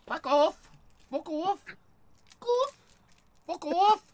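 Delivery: high squawking fuck off; chicken-like squawk. Pretend. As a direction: squawk it like a clucking hen. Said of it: "Sound like a chicken".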